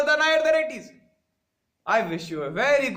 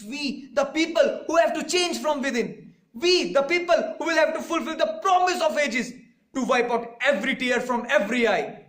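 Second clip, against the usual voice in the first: rousing climax; 6 semitones higher than usual. Acted. Climax of the oration.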